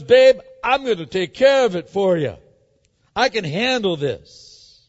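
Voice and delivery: loud, emphatic acted assurance; take-charge wife. Jezebel promising her husband she'll handle it, loudly taking charge. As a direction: belt it out as the one in command.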